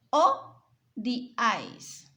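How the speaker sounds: Odiáis said slowly and loud, o-diáis; slow example word. Shown as a demonstration. Teacher pronouncing odiáis slowly for pupils. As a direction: stretch odiáis out for the class. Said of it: "Se separa en dos sílabas".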